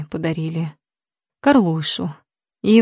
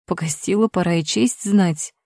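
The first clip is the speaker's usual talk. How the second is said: plain reading voice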